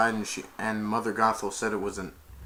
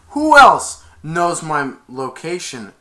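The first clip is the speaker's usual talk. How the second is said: louder and slower, pressing each word; stern demand; 5 semitones higher, 12 dB louder, slower than usual. Acted. Rapunzel questioning Flynn with a slow, loud demand. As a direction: interrogate him, slow and forceful.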